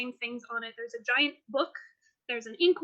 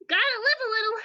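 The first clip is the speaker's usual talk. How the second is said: high, louder character voice; daring game character; 8 semitones higher, 5 dB louder, faster than usual. Acted. Depp excusing his rule-breaking to the party, high and bold. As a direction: pipe up with sudden boldness.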